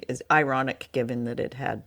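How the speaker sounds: normal talking voice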